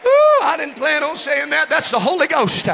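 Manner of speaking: high, urgent revival exhortation; full-force preaching. Real, not acted.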